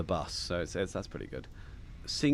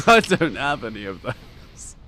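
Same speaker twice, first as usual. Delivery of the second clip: laughing mock repeat; slow amused repeat; 3 semitones higher, 7 dB louder, slower than usual. Repeats friend's words laughing.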